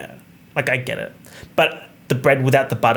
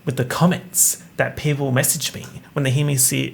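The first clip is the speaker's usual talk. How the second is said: normal talking voice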